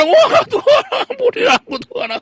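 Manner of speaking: very high, loud wailing plea, hammed up; mock crying prayer. Preacher mocks a repeat sinner begging God, loud high wail. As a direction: wail like a fake penitent. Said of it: "Nanti minggu depan Anda".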